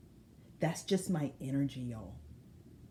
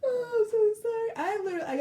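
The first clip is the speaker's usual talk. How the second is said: much higher, crying with laughter; laughing to tears; 4 dB louder than usual. Real, not acted. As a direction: gasp it out through tears of laughter. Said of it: "there are tears in my eyes".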